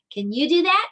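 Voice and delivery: normal talking voice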